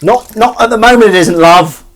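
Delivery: loud, high-pitched call across the room. Real, not acted. Answering someone asking about their kitchen, loud high call. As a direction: raise it right up to answer someone. Calling back to person in kitchen.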